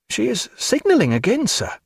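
high-pitched junior officer; inexpert midshipman. A narrator's reading. Signal midshipman reporting to captain, high-voiced. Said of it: "an inexpert one too".

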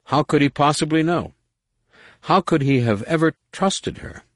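ordinary delivery

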